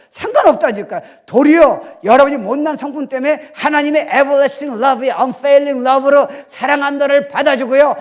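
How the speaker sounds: loud, high preaching voice. Acted. Pastor preaching loudly to the church. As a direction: preach it out to the congregation.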